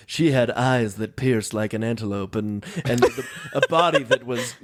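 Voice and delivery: a hint of Leslie Nielsen deadpan noir; noir detective. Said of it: "like when Leslie Nelson's character walks into a room".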